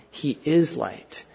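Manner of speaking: "is" leaned on, the phrase slowed right down; slow, weighty emphasis on "is"; stress on IS. Real, not acted. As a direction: land on "is" as the correction. Contrast with knows or has light.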